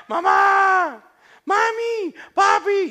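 very high, loud cries for help; child screaming. Acted. Little boy locked in the bathroom calling his parents, screaming. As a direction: yell like a panicking child. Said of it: "Y empieza a gritar".

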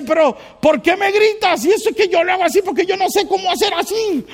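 high hurried wife complaint; wife's protest. Retold or imitated. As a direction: mimic the wife pleading why he yells. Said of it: "Y dice".

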